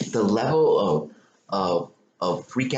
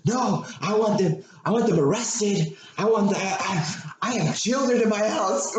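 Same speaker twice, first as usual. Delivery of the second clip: mock hysterical wife; 10 semitones higher than usual. Retelling neighbor wife freaking out.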